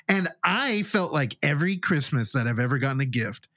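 normal talking voice

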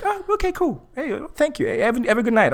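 high, breezy, cheerful brush-off; chipper guy. Retold or imitated. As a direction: bounce back brightly as the rejected guy. Rejected guy quoted, cheerful.

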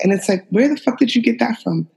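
ordinary talk, nothing marked

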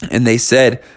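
ordinary delivery

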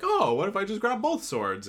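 mock-innocent excited suggestion; imitated bright idea. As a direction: pipe up high with a sudden clueless idea. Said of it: "and she's like".

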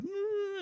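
very high, drawn-out "mm"; small squeaky whine through closed lips; high whimpered hum. Acted. Owner feeling bad about scolding the dog, whimpering.

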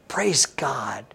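breathy hushed praise, no voice; whispered praise. Real, not acted. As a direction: breathe it out in awe. Whispered praise after reading.

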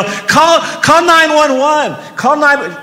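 high, loud emergency shout; urgent yell. Retold or imitated. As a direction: yell for someone to call for help. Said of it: "and I yell".

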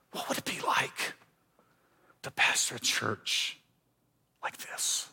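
hushed breathless whisper; whispered wish. Retold or imitated. Pastor whispering to himself in the empty room, hushed. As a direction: breathe it like a secret longing.

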